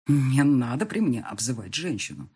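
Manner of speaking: plain reading voice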